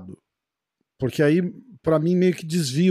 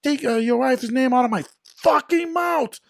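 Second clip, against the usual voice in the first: slow, higher English line; angry quote; 7 semitones higher than usual. Retold or imitated. Quoting [name]'s Oscar outburst.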